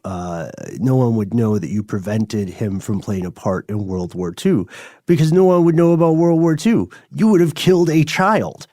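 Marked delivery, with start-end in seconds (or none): 5.1-8.7 higher, louder emphatic point. Real, not acted. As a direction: drive the point home. Emphatic punchline of argument.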